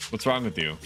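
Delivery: ordinary delivery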